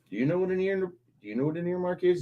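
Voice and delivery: high, soft voice for the dog; baby talk to the campaign dog. Acted.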